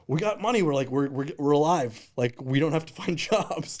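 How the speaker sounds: high, loud jubilant retelling; excited. Retold or imitated. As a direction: imitate a colleague bursting with good news. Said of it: "She was so excited".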